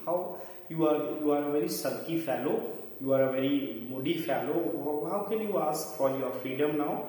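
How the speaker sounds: normal talking voice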